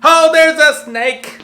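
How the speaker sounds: loud, high, drawn-out yell of alarm; alarmed cry. Acted. Vlogger spotting a snake, crying out in alarm.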